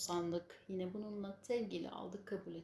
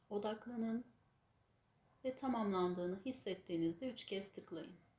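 guided meditation; 4 dB quieter than usual. Acted. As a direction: lead them gently through the image. Guides a visualisation.

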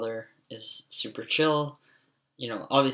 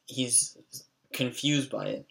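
usual speaking voice

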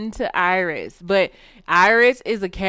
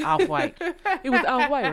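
laughing; 6 semitones higher than usual. Real, not acted. Laughing quote of husband.

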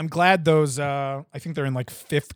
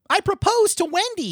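much higher, put-on kid voice; high cartoon boy; 3 dB louder than usual. Stan admitting to the parents, high cartoon boy voice. Acts Stan's confession in the story.

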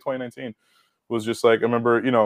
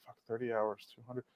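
muttered disbelief; 15 dB quieter than usual. Retold or imitated. Realizing the pay was tiny.

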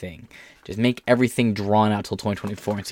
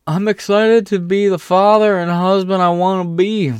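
much higher, louder mocking voice; mocking quote voice; 8 semitones higher, 4 dB louder than usual. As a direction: sneer it as a sappy quote.

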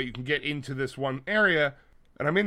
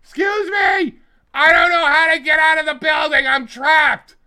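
high, loud screeching mock scream; hammed-up screeching woman yelling for help; Vicky Guerrero screaming; 12 semitones higher, 12 dB louder than usual. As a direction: scream across the lobby, trapped and frantic. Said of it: "sounds like Vicky Guerrero screaming".

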